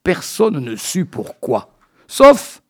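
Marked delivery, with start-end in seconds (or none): 2.1-2.6 sudden leap way up and loud on "sauf"; mock surprise. Storyteller springing the twist in his silly tale, high loud "sauf". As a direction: fling "sauf" up high like a punchline reveal. Comic turn in absurd tale.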